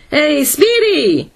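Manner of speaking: high, drawn-out howl; long howled call. A narrator's reading. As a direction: hail someone far off, slow and high. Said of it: "howled Donovan".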